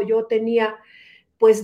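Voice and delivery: normal talking voice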